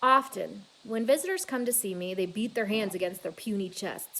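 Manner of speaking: plain reading voice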